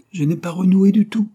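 high, loud, furious denial; furious cry. A narrator's reading. Irma crying out furiously at Jory. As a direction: shout it back at him in fury. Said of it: "cria-t-elle furieuse".

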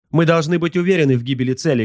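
high, fast subordinate's answer; high-voiced, hurried crew report; servile watch slave. A narrator's reading. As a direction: answer the master quickly in a high voice. Watch slave answers his master.

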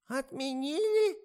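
очень высокий громкий вопрос; изумлённо переспрашивает; изумление. A narrator's reading. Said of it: "изумилась старуха". (изумлённый высокий старушечий вопрос)

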